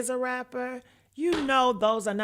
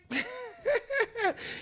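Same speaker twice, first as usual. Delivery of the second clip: squealing laugh; 14 semitones higher, 6 dB quieter than usual. Real, not acted.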